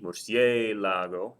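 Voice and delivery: slow murciélago, stretching the CIÉ; murciélago with a drawn-out, stressed CIÉ. Shown as a demonstration. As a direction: lean hard on the E in murciélago. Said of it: "the stress is over that E".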